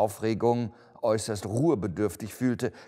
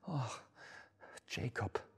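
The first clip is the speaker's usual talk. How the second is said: hushed, breath-filled moan; pleading; 5 semitones higher, 10 dB quieter than usual. A narrator's reading. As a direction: sigh the old partner's name, begging. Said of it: "rief er flehend". (leise, flehend gehaucht)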